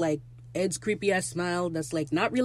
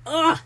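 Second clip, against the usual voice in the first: high loud delighted ugh; swooning groan; 10 semitones higher, 7 dB louder than usual. Real, not acted. Fan gushing over Pete and Lucius, swooning aloud. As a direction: let out an overwhelmed swoon.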